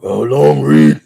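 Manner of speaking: low, slow gruff rumble; gruff comic-book brute impression; gruff Ben Grimm. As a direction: draw it out in a gruff voice. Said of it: "the gruff voice".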